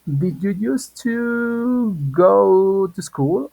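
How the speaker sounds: drawn out very slowly, each word apart; very slow model sentence. Shown as a demonstration. Teacher modeling a question for class, very slowly. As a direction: stretch it out for learners. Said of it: "Si yo digo".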